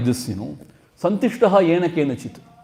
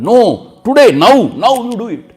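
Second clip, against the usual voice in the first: high, loud mock insistence; a pushy guest shouting his demand; loud insistent demand; 6 semitones higher, 8 dB louder than usual. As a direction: demand it right now, pushing hard.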